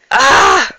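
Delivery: sudden high loud "ah"; startled cry. Real, not acted. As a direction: yelp in surprise. Baby bites, parent exclaims.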